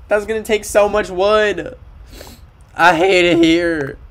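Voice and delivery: loud, high, slow complaint; exasperated whine. Real, not acted. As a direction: wail it out, dragging every word. Whining at the crafting cost.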